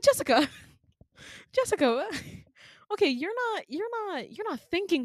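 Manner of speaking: high, loud pleading broken by laughs; exasperated pleading. Retold or imitated. Trying to stop Jessica jumping, exasperated pleading.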